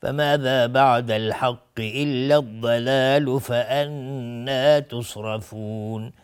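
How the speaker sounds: slow, drawn-out melodic Quranic chanting; Quran recitation. Shown as a demonstration. Tajwid teacher reciting a verse for students, slow chant. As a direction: chant the verse with long held vowels.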